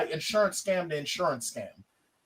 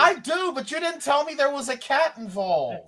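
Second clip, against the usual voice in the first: high-pitched protest; indignant retort; 8 semitones higher than usual. Real, not acted. Friend objects he was never told about the cat, indignant retort. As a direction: argue back with pitch lifted.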